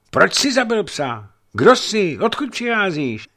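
high, loud angry demands; angry call. A narrator's reading. Young man angrily demanding why his dog was killed. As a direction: fire the questions at the stranger. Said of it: "zvolal hněvivě".

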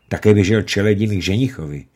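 ordinary delivery

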